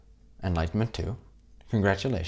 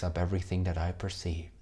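calm meditation voice with long pauses; guided meditation. Acted. Teacher closing the course for students, guided meditation. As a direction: lead them gently into rest, leaving space between phrases.

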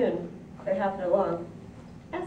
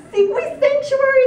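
very high, loud panicked cry; near hysteria; 11 dB louder than usual. A narrator's reading. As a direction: implore in a shriek. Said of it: "on the verge of hysteria".

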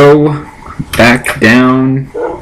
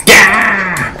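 gleeful cheer; 10 semitones higher than usual. Real, not acted. Chicken dies in shower.

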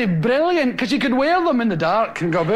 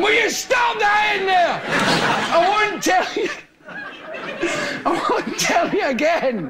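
sharp scolding call, a bit higher; mother scolding shout; 5 semitones higher than usual. Retold or imitated. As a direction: warn the kids one last time.

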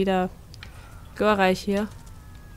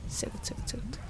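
whispering; 15 dB quieter than usual. Real, not acted. Whispered satisfaction.

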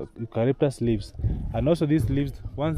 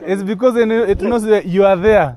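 talking through laughter, louder and higher; laughing at the dog showing off; 7 semitones higher, 9 dB louder than usual. Real, not acted.

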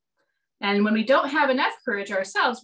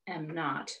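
much softer, hushed reply; whispered; 6 semitones lower, 10 dB quieter than usual. Acted. Louise quietly answering her teasing sister, hushed. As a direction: barely breathe it out. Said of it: "whispered Louise".

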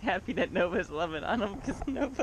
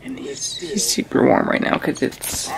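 whispering over a crib; 11 dB louder, slower than usual. Real, not acted. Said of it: "so I don't wake him up".

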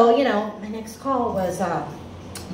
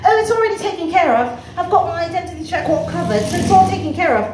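put-on higher, breezy British-accented assurance; 5 semitones higher than usual. British debtor claiming the bill is covered, British accent. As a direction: dismiss it airily in a British accent. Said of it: "he's got a British accent".

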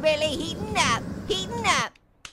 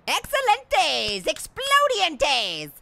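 mock Spanish; much slower than usual.

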